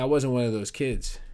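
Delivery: normal talking voice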